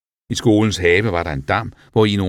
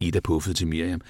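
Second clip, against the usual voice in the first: ordinary delivery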